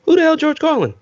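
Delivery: high, loud baffled shout; confused loud retort. Retold or imitated. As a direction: blurt it out, baffled. Friend's confused reply.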